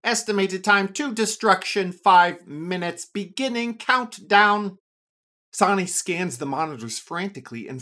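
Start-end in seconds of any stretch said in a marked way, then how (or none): 0.0-3.1 loud, level computer announcement; a machine alarm voice; robotic voice. A narrator's reading. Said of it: "a robotic voice says". 3.1-4.8 loud, level machine countdown; a computer alarm announcing; robotic voice. A narrator's reading. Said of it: "a robotic voice says".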